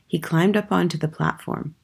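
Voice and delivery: ordinary delivery, nothing marked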